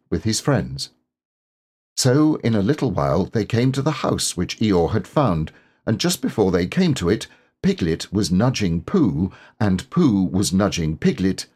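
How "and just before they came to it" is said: ordinary delivery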